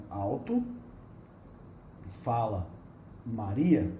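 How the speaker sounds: each word alone, with long pauses; "alto", pausa, "fala", pausa, "Maria", bem devagar; slow word by word. Shown as a demonstration. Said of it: "ou seja". (separando "alto fala Maria" palavra por palavra com pausas longas)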